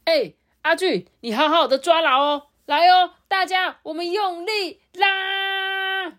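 high, rallying call to the team; rescue worm leader. Acted. Rescue worm rallying the team to pull, high and urgent. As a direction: cheer everyone on to pull.

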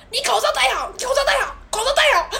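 put-on high, loud scolding of an old man. Security guard scolding an old man at the door, shouting. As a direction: bark the order to put the mask on. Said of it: "大声地斥责那个阿伯说".

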